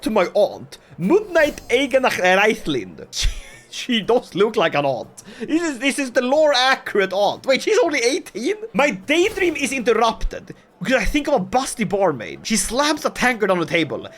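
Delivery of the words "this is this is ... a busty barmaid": ordinary talk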